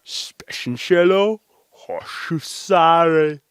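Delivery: "hoshusare" drawn long, like reversed speech; speaking backwards. Shown as a demonstration. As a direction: say "Speshenshelo hoshusare" as reversed syllables, stretched out. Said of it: "she can speak backwards".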